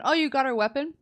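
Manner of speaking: ordinary talk, nothing marked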